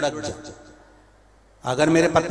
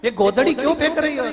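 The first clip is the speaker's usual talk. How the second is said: put-on high-pitched, quick child's question; little child; 9 semitones higher, much faster than usual. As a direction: ask like a curious little kid. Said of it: "छोटा बच्चा पूछेगा". (छोटे बच्चे की ऊँची आवाज़ में सवाल)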